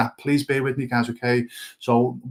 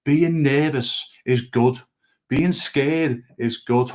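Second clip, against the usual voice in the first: each word set down slowly and firmly; slow emphatic lesson line; much slower than usual. Shown as a demonstration. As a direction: drive the key lesson home slowly. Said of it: "please listen to what I'm gonna say".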